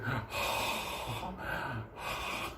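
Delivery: long breathy mock panting; drugged dog panting. Storyteller mimics the dog getting knocked out, heavy sighing breaths. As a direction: wheeze out like a dog passing out. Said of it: "this fucking dog is like".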